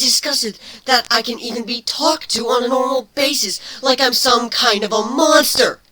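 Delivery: high, loud, hurt outburst from the changeling; a wounded creature's rising rant; bitter changeling. Acted.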